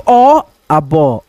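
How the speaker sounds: ब and अब spelled out very slowly, low; slow blending demo. Shown as a demonstration. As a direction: sound out ब, अब slowly for pupils. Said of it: "मन में बोलो". (धीरे-धीरे ब, अब जोड़कर बोलें)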